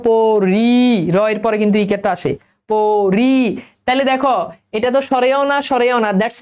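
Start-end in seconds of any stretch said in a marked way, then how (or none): 0.1-0.7 পরি split into long "po" and "ri"; syllable-by-syllable demonstration. Shown as a demonstration. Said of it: "তুমি উচ্চারণ করো". 2.7-3.4 পরি split into long "po" and "ri"; syllable-by-syllable demonstration. Shown as a demonstration. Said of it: "তুমি উচ্চারণ করো".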